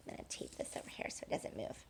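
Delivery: fast, low whispered aside. Real, not acted. Teacher whispers while taping the paper down. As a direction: mutter it fast under your breath.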